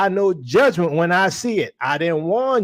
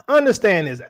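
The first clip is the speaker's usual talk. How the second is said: normal talking voice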